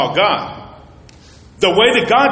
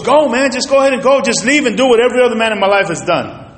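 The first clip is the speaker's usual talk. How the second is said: higher, fast hurt outburst; retold angry plea; 6 semitones higher, 3 dB louder, faster than usual. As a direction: throw the words at him like a wounded wife. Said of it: "She goes".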